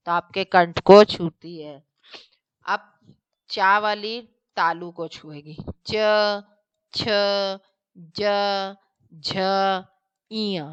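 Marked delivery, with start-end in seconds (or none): 5.9-10.7 each letter said clearly, tongue on palate: "च", "छ", "ज", "झ"; palatal consonants demo. Shown as a demonstration. Teacher to students, sounding out the palatal letters "च छ ज झ" carefully. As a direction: sound out "च" to "इया" one at a time. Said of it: "आप बोल के भी देख लीजिएगा".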